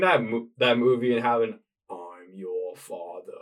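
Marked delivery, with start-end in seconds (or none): 1.9-3.3 Darth Vader voice. Retold or imitated. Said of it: "Try that one more time".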